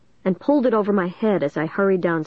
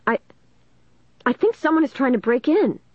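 halting, then higher-pitched alarm; alarmed cry; 7 semitones higher than usual. A narrator's reading. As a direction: stammer a start, then blurt it urgently. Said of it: "I cried".

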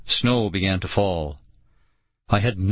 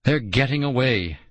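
ordinary delivery

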